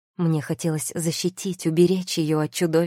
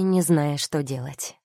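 plain reading voice

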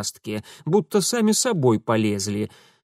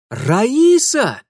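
much higher, loud outraged call; shocked loud rebuke; indignant cry; 10 semitones higher, 8 dB louder than usual. A narrator's reading. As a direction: yell the lynx's name in outrage. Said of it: "возмущённо вскричал Урусов".

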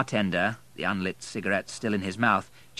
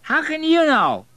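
put-on very high, loud drunken outburst; drunken indignant cry; angry drunk; 10 dB louder than usual. A narrator's reading. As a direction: lash out angrily, slurring. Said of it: "said Matthew angrily".

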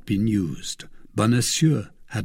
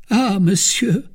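cry; 10 semitones higher, 6 dB louder than usual. A narrator's reading. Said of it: "cried Planchet".

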